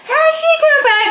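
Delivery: high, loud cry of delight; excited shriek. Real, not acted. Seeing Marie Laveau come back, a high excited shriek. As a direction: shriek it in happy disbelief.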